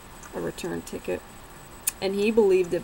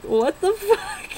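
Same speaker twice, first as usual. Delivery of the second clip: mock outraged boyfriend; 12 semitones higher, 4 dB louder, slower than usual. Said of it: "he's like".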